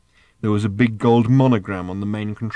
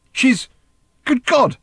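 high, loud cry of disbelief; astonished shout; 6 dB louder, slower than usual. Acted. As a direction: break off, then exclaim in shock. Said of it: "shouted Arthur".

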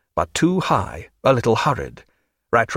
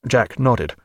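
usual speaking voice

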